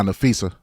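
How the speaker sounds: Nafisa with a clear s, "na-FEE-sa"; corrected pronunciation. Shown as a demonstration. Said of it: "how you pronounce her first name?".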